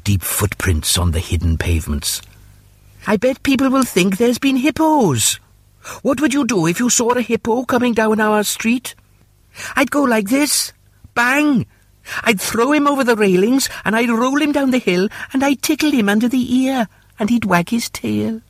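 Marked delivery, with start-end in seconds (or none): 3.1-9.0 put-on very high-pitched little kid voice; excited child. Children talking in the story. 9.8-18.4 put-on very high little-kid voice; child boasting playfully. Child answers the hippo question.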